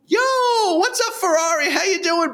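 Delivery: high, breezy, overly friendly; Tiny's greeting. Retold or imitated. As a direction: act like nothing's wrong. Tiny's cheerful greeting when caught.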